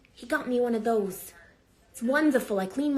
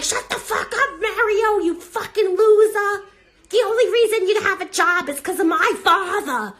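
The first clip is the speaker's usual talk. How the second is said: high, loud hammed-up marital fight; acted screeching retort; shouted comeback; 9 semitones higher, 7 dB louder than usual. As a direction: yell back at your useless husband. Acted screaming match with husband.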